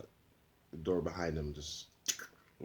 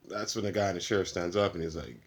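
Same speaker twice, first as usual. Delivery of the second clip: ordinary delivery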